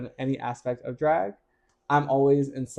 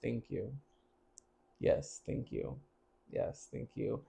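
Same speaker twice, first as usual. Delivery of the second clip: much softer, flat whispered thanks; deadpan thank you; 4 semitones lower, 16 dB quieter, slower than usual. Retold or imitated. S0 retelling her deadpan thanks to the crowd, much softer. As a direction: mutter thanks with a straight face. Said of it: "sitting there with a straight face".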